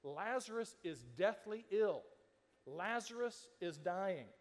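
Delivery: slow, weighty delivery; grave. Shown as a demonstration. Pastor stressing how serious it is, slow and grave. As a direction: let each word land heavy and slow. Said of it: "This is".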